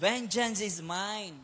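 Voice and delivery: slow, solemn declaration; solemn quote. Acted. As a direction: say it slowly as the voice of God. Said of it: "sabi ng Panginoon". (mabagal at taimtim na pahayag ng Panginoon)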